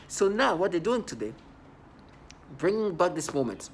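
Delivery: usual speaking voice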